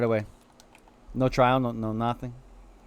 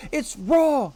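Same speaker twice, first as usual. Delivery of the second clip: high, exaggerated "raw" outburst; mock chef outrage; 10 semitones higher than usual. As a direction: play up the disgusted chef's cry. Quoting an angry chef catchphrase.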